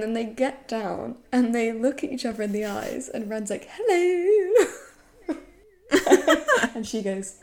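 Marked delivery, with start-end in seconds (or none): 3.8-4.7 high, loud, lilting hello; playful imitated greeting. Drunk man meets her eyes on the floor, silly hello. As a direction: sing out the hello, on the edge of laughter.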